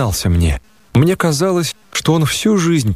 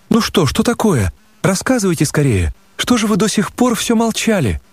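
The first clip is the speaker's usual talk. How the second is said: put-on a touch higher, girlish and affectionate; Nastenka, lightly sketched, fond and teasing; lightly voiced young girl; 8 semitones higher than usual. A narrator's reading. Nastenka fondly thanks the narrator, lightly voiced girl.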